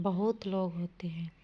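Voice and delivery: breathy aside with the answer; whispered correction. Shown as a demonstration. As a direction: whisper the right form. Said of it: "बहुत सारे लोग नहीं".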